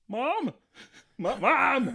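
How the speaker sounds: put-on high, loud child's cry for mom; panicked calling out. A scared kid calling his mom from the next room, high and loud. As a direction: yell for mom like a scared five-year-old.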